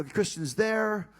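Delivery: ordinary talk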